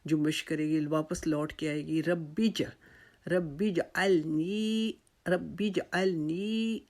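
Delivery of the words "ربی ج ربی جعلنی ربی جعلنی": ربی جعلنی very slow, the ج bounced back in the throat; qalqala. Shown as a demonstration. Teacher demonstrating qalqala on ربی جعلنی. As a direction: slowly recite ربی جعلنی with an echoing ج. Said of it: "اس کے اوپر ہم نے قلقلہ کرنا ہے".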